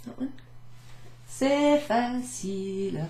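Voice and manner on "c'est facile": easy, breezy demo. Shown as a demonstration. Adjektiv langsam vorgespielt.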